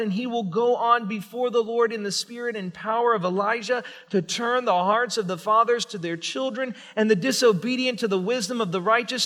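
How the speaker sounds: ordinary talk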